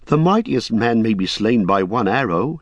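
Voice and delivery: put-on a young hobbit recalling the fight; young hobbit Pippin. Pippin defends Boromir.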